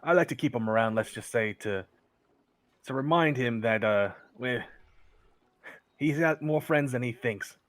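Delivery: vouching for his friend; a hint of a loyal old companion; Sheldon's voice.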